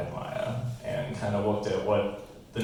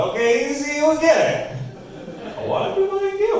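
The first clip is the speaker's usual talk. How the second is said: patronising; 5 dB louder than usual. Pretend. Condescending explanation to crowd.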